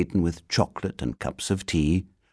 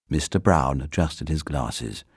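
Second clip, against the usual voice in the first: ordinary delivery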